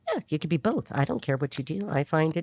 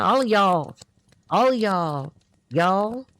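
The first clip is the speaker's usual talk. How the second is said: louder, hammed-up Southern 'y'all'; mock Southern accent; 3 semitones higher, 5 dB louder, much slower than usual. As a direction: play a Southerner saying 'All of y'all'. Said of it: "Somebody speaks like that".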